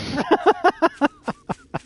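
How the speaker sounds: shrieking high laugh; loud, very high-pitched laugh. Real, not acted. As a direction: let out a squealing laugh. Laughs at sardine story.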